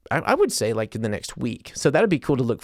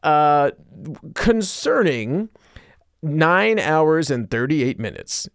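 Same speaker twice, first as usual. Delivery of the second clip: mock phone announcer; slower than usual. Mocking his iPhone's screen time alert, hammed-up announcer. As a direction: stretch each damning word like a smug announcer. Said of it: "But they're like".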